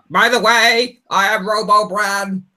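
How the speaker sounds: high, slow grand robotic pronouncement; mocking a robotic know-it-all Bran. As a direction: play an all-knowing robot oracle. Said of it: "Branopedia will show up and be like".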